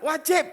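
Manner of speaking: "Wajib" hit high and loud; emphatic answer. Real, not acted. Preacher insists to the congregation that worship is obligatory, answering loud and high. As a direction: bark out "Wajib" as a firm verdict. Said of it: "Penting nggak?".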